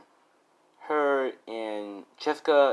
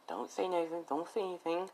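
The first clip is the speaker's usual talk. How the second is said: soft, higher hushed warning to herself; 7 semitones higher, 11 dB quieter than usual. Acted. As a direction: say it under your breath before opening the door. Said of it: "as Jessica's like".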